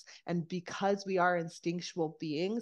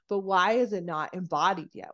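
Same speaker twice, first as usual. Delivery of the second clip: ordinary delivery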